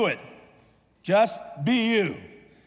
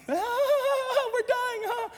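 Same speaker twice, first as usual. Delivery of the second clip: put-on high squealing kids' laugh through the words; mock laughing; 13 semitones higher, much slower than usual. Children laughing while caught in a riptide, mock laughing. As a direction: imitate giggly kids, voice pitched way up. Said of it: "they were laughing".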